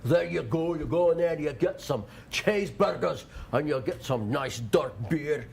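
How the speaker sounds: high, hammed-up Scottish accent; botched Scottish brogue that veers toward Schwarzenegger; mock Scottish accent. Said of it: "You sound like Arnold Schwarzenegger".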